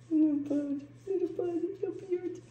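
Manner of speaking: soft, high pet talk. Real, not acted. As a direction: coo gently to the little one. Talking to a pet.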